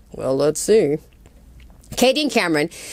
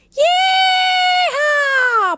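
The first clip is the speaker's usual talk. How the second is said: much higher, loud cowboy whoop; yeehaw shout; 3 dB louder than usual. Pretend. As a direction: whoop like a rodeo rider. Cheering while riding off.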